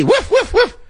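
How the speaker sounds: very high-pitched little woofs; mock dog bark. Host plays a dog being called in, high mock barking. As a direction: yip like a small dog.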